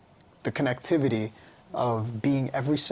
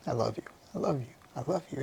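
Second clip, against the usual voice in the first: quick, softer rush of love yous; hurried; 6 dB quieter, much faster than usual. Retold or imitated. Standing at the mirror, rushing softly through affirmations. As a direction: run them together quietly. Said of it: "I'm like, Okay".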